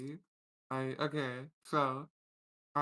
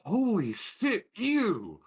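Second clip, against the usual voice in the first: higher, louder, slow disgusted cry; disgusted shock; 8 semitones higher, 7 dB louder, much slower than usual. Acted. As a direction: recoil in horror and disgust. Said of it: "She goes".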